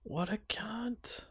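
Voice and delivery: slow, breathless whispered insult; shocked whisper. Real, not acted. Hearing his friend got conned, whispered in disbelief. Shocked listener reacts to story.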